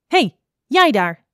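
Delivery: high, drawn-out shout to get attention; loud call. A narrator's reading. Snake hails a passing little adder, loud call. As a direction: call out loudly to someone over there. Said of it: "riep ze met een luide stem".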